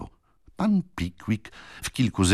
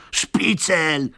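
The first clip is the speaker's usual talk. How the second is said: shout from the crowd; 8 semitones higher than usual. Acted. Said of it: "krzyknęło kilka głosów z tłumu".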